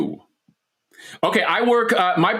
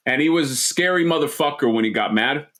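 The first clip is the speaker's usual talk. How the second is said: ordinary talk, nothing marked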